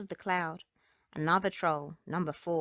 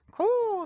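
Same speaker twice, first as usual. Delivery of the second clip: high, loud bird caw; a sharp crow cry; rook caw; 8 dB louder than usual. Acted. Rook calling overhead, a high loud caw. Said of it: "says the rook".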